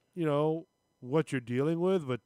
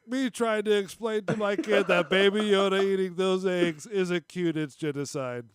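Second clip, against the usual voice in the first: louder, higher mock quote; mocking read-out; 4 semitones higher, 4 dB louder than usual. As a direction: read it out loudly in mock disbelief. Incredulous louder re-read of tweet.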